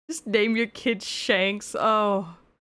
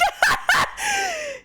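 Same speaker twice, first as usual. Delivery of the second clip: very high, squealing laugh; helpless high-pitched cackle; laughing burst. Real, not acted.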